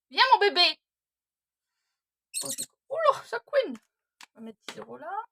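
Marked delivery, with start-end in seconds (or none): 0.1-0.8 loud, high call to the dog. Real, not acted. Calling the dog again. 2.4-3.7 high, loud startled cry; startled exclaim. Real, not acted. Startled by squeaking dog.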